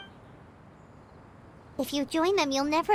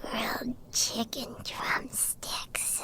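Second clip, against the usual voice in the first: slow, soft mumbled whisper while asleep; sleep talking; 8 semitones lower, 9 dB quieter, much slower than usual. Acted. Paimon dreaming of drumsticks, mumbling in her sleep. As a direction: murmur it half-asleep, dreaming of food. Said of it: "Paimon had a dream about eating".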